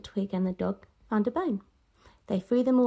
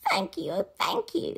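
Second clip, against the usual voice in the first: higher, grateful thanks; grateful cry; 8 semitones higher than usual. Acted. Grateful witch thanks her friends, higher voice. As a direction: thank them with relief. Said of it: "the grateful witch cried".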